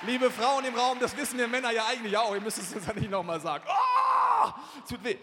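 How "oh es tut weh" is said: high, loud cry of pain; pained shout. Retold or imitated. Preacher reliving the landing pain, shouting that it hurts. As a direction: yell that it hurts.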